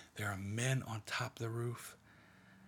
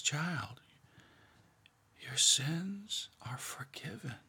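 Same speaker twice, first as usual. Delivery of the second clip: gentle forgiveness; 4 semitones higher, slower than usual. Acted. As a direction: lay the words down tenderly, slowly. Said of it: "There's no inordinate energy. Jesus just says".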